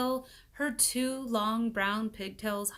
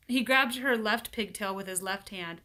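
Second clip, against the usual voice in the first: ordinary delivery, nothing marked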